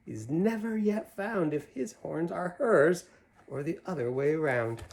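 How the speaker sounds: put-on lively read-aloud to kids; animated picture-book reading; story narration. A narrator's reading. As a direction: read the rhyme with a bouncy lilt. Reads Seuss poem to children.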